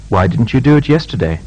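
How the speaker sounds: "Why didn't you do it yesterday?" rising all through, then a short drop on the last word; "Why didn't you do it yesterday?" asked frankly, rising then a brief fall; rising tune, short final fall. Shown as a demonstration. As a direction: let the pitch climb steadily and fall only at the very end. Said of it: "continuous rising intonation with a final short fall".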